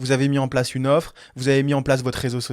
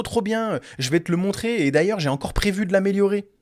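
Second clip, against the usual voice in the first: imitating an over-keen client, higher pitched; eager client; 6 semitones higher than usual. Client dodging the tests to show off posts and chatbot, eager higher voice. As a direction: play a client gushing in one question after another. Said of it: "Et à la fin, il me dit quoi?".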